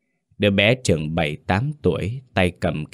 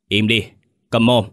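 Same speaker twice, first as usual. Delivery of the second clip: raised, forceful command to shut up; angry roar; 4 semitones higher, 5 dB louder than usual. A narrator's reading. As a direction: snap angrily, a little higher and louder. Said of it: "Lão rống lên". (quát lên giận dữ)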